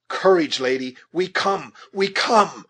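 high, loud rallying shout; urgent hollered promise of rescue. A narrator's reading. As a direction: call out encouragement to a distant captive. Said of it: "shouted the scout".

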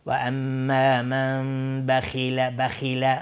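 slow recitation, heavy kh in بخیل; بخیل with a thick back-of-mouth kh; thick kha. Shown as a demonstration. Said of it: "غلیظ تلفظ بشه".

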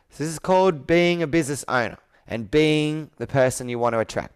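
usual speaking voice

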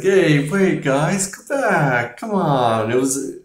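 mock-beg the crowd to come back; a hammed-up sheriff pleading with the crowd; pleading Brody. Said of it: "he's like".

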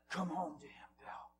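sudden drop to a whisper; retold whispered invitation; hushed appeal. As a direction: whisper it as a personal plea. Quiet appeal amid loud retelling.

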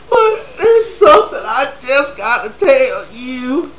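high, loud hammed-up sobbing confession; mock sobbing. Guest sobbing to Maury about her boyfriend. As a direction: blubber it out in a wail.

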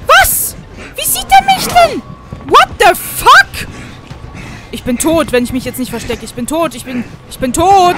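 shrieking in high terror; real panic, yelling high and loud; panicked screaming. Real, not acted. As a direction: scream it, you're about to die. Monster sees him, panic.

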